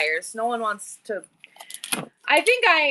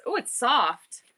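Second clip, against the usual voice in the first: ordinary talk, nothing marked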